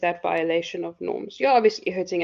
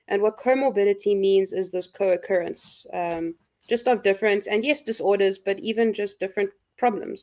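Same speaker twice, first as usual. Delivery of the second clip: ordinary talk, nothing marked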